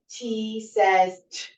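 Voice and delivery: slow T says t, sound isolated; letter name then sound. Shown as a demonstration. Teacher modeling letter and sound for parents, slow and clear. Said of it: "they would say".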